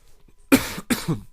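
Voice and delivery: loud sudden cough; one sharp cough; real cough. Real, not acted.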